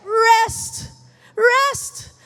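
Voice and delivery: high, loud single-word cry; exultant proclamation. Real, not acted. Preacher quoting the Lord's promise, exultant proclamation. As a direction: proclaim "rest" like a climax. Climax of quoted scripture promise.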